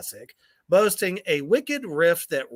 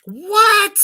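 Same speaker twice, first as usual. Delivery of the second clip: high loud shocked what; outraged what; 14 semitones higher, 11 dB louder than usual. Real, not acted. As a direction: shout it in outrage. Outraged at ranking.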